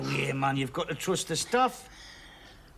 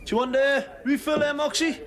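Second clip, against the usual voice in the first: calling out; 10 semitones higher, 5 dB louder than usual. Acted. Calling across the bar.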